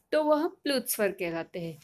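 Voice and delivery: ordinary delivery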